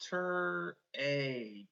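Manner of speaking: TER, then A as long "ay", held apart; sounded-out word parts. Shown as a demonstration. Teacher checking a reading word, sounded out slowly. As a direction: stretch TER and A as separate sounds. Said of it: "Check it with me".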